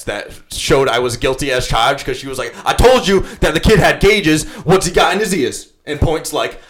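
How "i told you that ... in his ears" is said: higher, louder mock accusing girl; drunk accuser. Drunk sister accusing the speaker in front of her friends, higher and louder. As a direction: play the drunk girl making her case. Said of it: "like she was presenting evidence".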